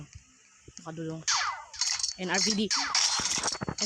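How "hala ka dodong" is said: unvoiced, much softer whispered taunt; a quiet whispered taunt; whispered tease. Real, not acted. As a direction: breathe it as a sly whisper.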